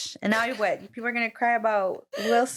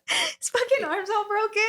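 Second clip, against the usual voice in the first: words squeezed out high through laughter, a pitch much higher; can't stop laughing at the picture; 10 semitones higher than usual. Real, not acted. Friend laughing at the gurney photo, high laughing voice.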